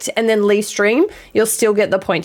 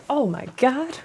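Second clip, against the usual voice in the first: normal talking voice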